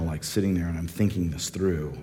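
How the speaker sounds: ordinary talk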